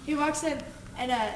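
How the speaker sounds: normal talking voice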